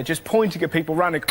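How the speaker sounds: ordinary talk, nothing marked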